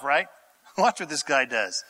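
normal talking voice

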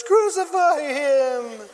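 high, drawn-out jeer; mock crowd cry. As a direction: call it out like the mob, stretched long. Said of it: "the crowd says".

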